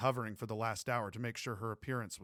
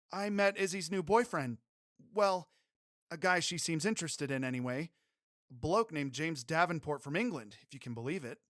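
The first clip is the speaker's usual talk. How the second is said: put-on higher young woman's voice; Emmy Jo voice; 6 semitones higher, 5 dB louder than usual. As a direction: raise the pitch for the heroine.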